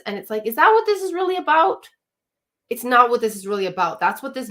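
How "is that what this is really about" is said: skeptical challenge. Retold or imitated. Coach challenging a wife's complaint.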